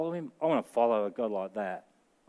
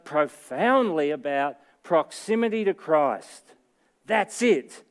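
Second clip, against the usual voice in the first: full-force preaching; 5 semitones higher, 3 dB louder than usual. Acted. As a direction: preach the invitation hard, with rising fervour. Preaching Jesus' invitation at full force.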